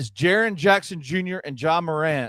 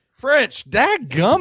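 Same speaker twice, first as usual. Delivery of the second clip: frustrated outburst; 7 semitones higher, 6 dB louder, slower than usual. Real, not acted. Frustrated surprise.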